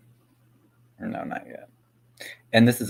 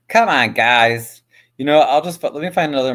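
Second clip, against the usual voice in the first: loud complaint; 4 semitones higher, 10 dB louder than usual. Real, not acted. Annoyed at an unfunny clip.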